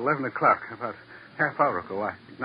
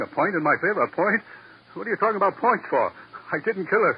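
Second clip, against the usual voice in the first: rising indignant outburst; defensive suspect snapping back; indignant protest; 8 semitones higher than usual. Acted. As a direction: throw his words back at him. Accused man protests angrily.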